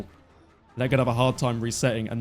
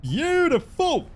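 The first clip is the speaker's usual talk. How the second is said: drawn out delight; 5 dB louder, much slower than usual. Real, not acted. Celebrating hit.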